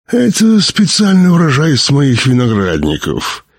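high, smooth character voice falling gradually; high-pitched doctor presenting his own wine; smiling doctor. A narrator's reading. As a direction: answer with a smile, starting high. Doctor smiles, answers in character voice.

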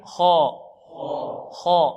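خاء as a full, back-of-mouth khaa with lips relaxed; heavy kha, unrounded lips. Shown as a demonstration. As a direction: thicken خاء without pursing the lips. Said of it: "بدون ضم شفتين". (خاء مفخمة بدون ضم الشفتين)

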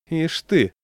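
high mocking snort of words; a touch of sarcasm from the savvy neighbour; sarcastic scoff. A narrator's reading. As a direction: sneer it with a smirk. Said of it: "язвительно хмыкнул".

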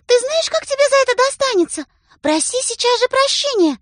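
very high, loud command; demanding apology. A narrator's reading. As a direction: order him to apologize.

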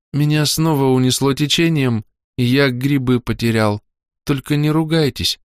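a hint of a coughing boy pleading; sickly young soldier. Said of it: "от разрывающего кашля".

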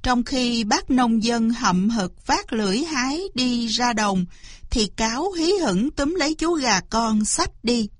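ordinary delivery

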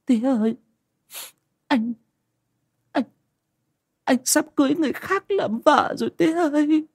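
high-pitched wail, broken by pauses; sobbing daughter. A narrator's reading. Daughter telling father she is jilted, crying hard. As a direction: choke out the news in a high tearful voice. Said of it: "cô lại khóc ngất lên".

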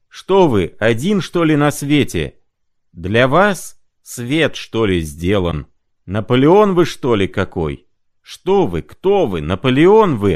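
high, loud furious questioning; furious tirade. Acted. Mark Ivanovich raging at Prokharchin about Napoleon, loud. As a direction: shake with rage at him. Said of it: "в исступлении, весь дрожа от досады".

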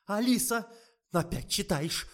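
high, loud bark of a name; doctor barking at the nurse caught reading. Acted. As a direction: snap at her like a boss catching her slacking. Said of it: "и гаркнула".

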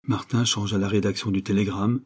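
plain reading voice

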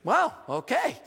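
mock-shocked gasp of a wow; startled wow. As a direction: react like the music just blasted you. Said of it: "and I'm like".

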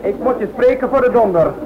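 shouting. Acted. Young pilot told to speak up, shouts at the director. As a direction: bellow it at the boss, fed up. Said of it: "maar je kan goed schreeuwen".

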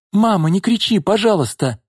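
put-on high-pitched anxious child; nervous plea. A narrator's reading. Daughter begging mother not to shout, nervous. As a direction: beg gently, a touch nervous. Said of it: "нервно попросила Алёнка".

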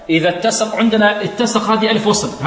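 ordinary talk, nothing marked

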